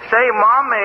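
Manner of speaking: talking around a lollipop; words round a sweet in the mouth; sucking a lollipop. Retold or imitated. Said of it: "Take that lollipop out of your mouth".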